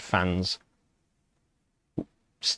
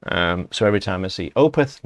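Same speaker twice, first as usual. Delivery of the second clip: ordinary delivery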